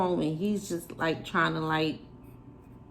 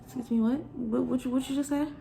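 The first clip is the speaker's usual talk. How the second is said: incredulous; 6 semitones higher, faster than usual. Retold or imitated. Girlfriend stunned by his request.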